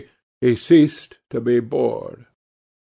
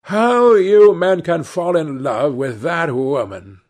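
put-on high, loud old-lady exclamation; old lady exclaiming in disbelief; 6 semitones higher, 7 dB louder than usual. A narrator's reading. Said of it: "exclaimed the old lady".

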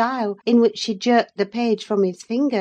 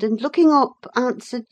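plain reading voice

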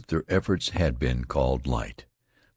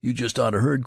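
higher-pitched eager news-bearer; mildly excited soldier calling a pal; eager cry; 6 semitones higher than usual. A narrator's reading. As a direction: break great news eagerly. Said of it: "cried one eagerly".

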